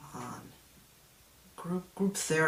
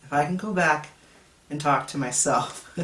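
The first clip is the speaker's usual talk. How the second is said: normal talking voice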